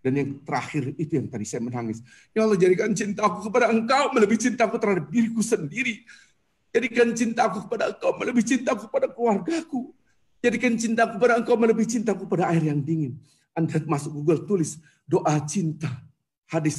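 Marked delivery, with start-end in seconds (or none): 2.3-13.2 high, loud pleading supplication; impassioned prayer. Acted. Preacher leading the congregation in prayer, impassioned. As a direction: pour the plea out to God. Leads prayer at full force.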